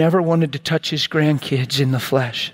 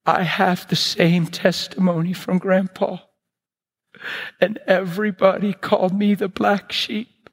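cousin's halting, emotional testimony; tearful retelling; 5 semitones higher than usual. Retold or imitated. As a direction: imitate a crying cousin, understated. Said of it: "came running up to me and cried".

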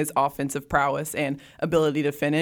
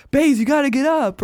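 higher, louder imitated courtside call; imitated sideline chirping; 8 semitones higher, 4 dB louder than usual. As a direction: mimic yelling encouragement from the bench. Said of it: "hear him chirping from the sidelines".

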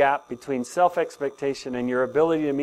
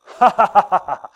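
loud burst of demo laughter, higher; 5 semitones higher, 9 dB louder than usual. Shown as a demonstration. Pastor demonstrating the laughter exercise: hearty laugh. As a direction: throw out a big hearty laugh.

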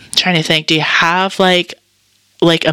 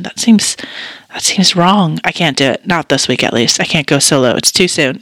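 ordinary talk

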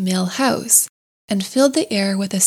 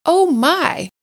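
high, swooping wonder; delighted gasp; 8 semitones higher than usual. A narrator's reading. Mole unpacking each piece of food, delighted gasp. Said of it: "still gasping".

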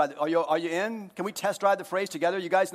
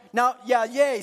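usual speaking voice

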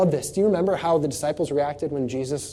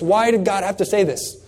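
ordinary talk, nothing marked

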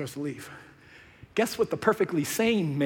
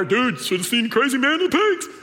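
loud, high, eager telling; breathless gossip shouting the story; excited herdsman; 6 semitones higher, 4 dB louder than usual. Retold or imitated. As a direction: blurt out the wild news. Herdsmen shouting news to town.

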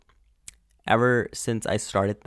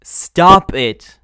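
loud, higher retold command; sharp telling-off; 5 semitones higher, 9 dB louder than usual. Annoyed viewer to a man filming the screen, loud telling-off. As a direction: tell them off loudly. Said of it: "look at him and be like".

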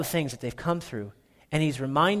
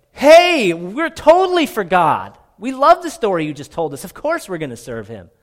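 much higher and louder, gushing agreement; eager crowd; 4 semitones higher, 8 dB louder than usual. Acted. The people cheering back to Joshua, eager and loud. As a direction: play the overeager crowd.

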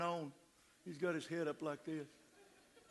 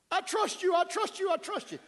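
high, loud, desperate repetition; drowning man begging; frantic pleading; 8 dB louder than usual. Retold or imitated. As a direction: gasp it out in panic. Panicked man repeating.

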